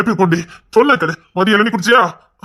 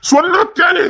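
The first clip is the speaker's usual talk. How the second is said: high-pitched exasperated command; angry shout; 11 semitones higher, slower than usual. Acted. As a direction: cry it out, out of patience. Husband loses patience.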